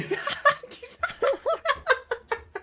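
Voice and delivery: squealing laugh; high laughing fit. Real, not acted.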